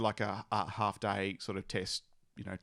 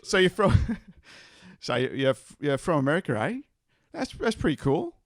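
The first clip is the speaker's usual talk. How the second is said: high, loud Bogan farmer voice; broad Australian accent; 5 semitones higher, 6 dB louder than usual. Acted. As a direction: ham up a broad Aussie farmer. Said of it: "your Bogan farmer accent".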